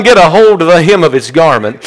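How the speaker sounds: quick, run-together preaching phrase; brisk exhortation. Real, not acted. Preacher urging those who are down, brisk exhortation. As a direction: move swiftly through the line. First take of repeated exhortation.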